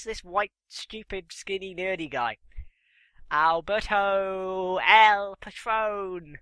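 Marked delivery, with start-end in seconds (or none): 3.3-6.4 very slow drawn-out ring intro; slow ring announcer. Pretend. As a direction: stretch every syllable like an announcer.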